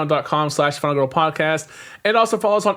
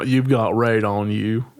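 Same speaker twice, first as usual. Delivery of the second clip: Forrest Gump impression; 3 semitones lower, slower than usual. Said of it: "it sounds more Forrest Gump".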